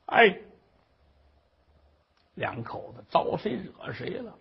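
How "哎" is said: usual speaking voice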